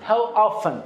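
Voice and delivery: "often" with no T, "off-en"; "often" smoothly said as "offen"; silent T. Shown as a demonstration. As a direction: say "How often" leaving the T out of "often". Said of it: "تی تلفظ نمیشه".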